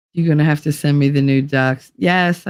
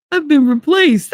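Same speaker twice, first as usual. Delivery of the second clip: high, hammed-up lament; melodramatic outcry; 11 semitones higher, 4 dB louder than usual. Brother replaced by his nephew, mock melodramatic. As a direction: wail it out in mock heartbreak. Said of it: "he was so melodramatic about it".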